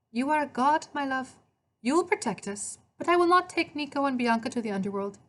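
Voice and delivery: high Italian woman, trusting but firm; Italian accent. A narrator's reading. Maria refusing to move the children, Italian accent. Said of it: "she spoke with an Italian accent".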